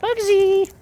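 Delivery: high loud call of the name. Real, not acted. Calling bird by name.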